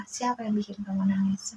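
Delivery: hushed whisper to her crying daughter. Retold or imitated. Mother whispering to her crying child, who made you cry. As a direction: breathe it softly, no voice.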